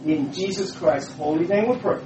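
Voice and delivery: ordinary talk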